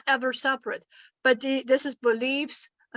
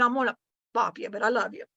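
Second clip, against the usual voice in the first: ordinary talk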